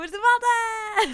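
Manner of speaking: high, loud triumphant cheer; cheerleader finale. Acted. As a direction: belt out the big finish of the chant. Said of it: "tá na hora das cheerleaders".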